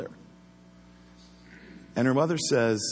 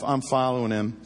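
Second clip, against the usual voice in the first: ordinary talk, nothing marked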